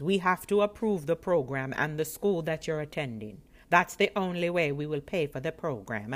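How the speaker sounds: loud, by-the-book official; serious clerk. Retold or imitated. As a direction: recite rules like a stern clerk. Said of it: "And she was so serious".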